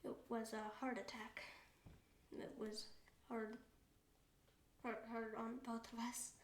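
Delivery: hushed, halting grief; low, subdued voice of loss; grieving. Real, not acted. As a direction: tell it softly, with long heavy pauses. Recounting mother's death.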